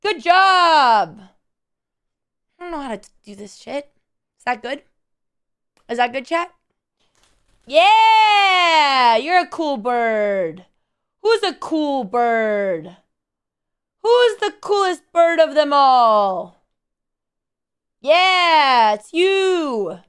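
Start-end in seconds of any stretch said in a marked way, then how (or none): 0.0-1.2 loud, high drawn-out cheer; hyped praise. Pretend. Hyping up the bird. 7.7-20.0 drawn-out cooing cheer; hyped pet praise. Pretend.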